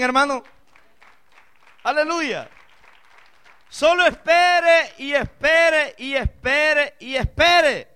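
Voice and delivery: rising fervent sermon cry; full-force preaching. Acted. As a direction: preach it out to the whole church.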